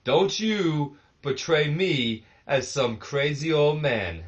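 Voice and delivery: a second go at the old champion's voice, hammed up; another try at Flair. Commentator retrying his impression of the angry wrestler, slowly. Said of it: "let me try something else here real quick".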